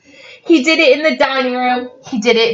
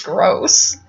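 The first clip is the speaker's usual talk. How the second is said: ordinary delivery, nothing marked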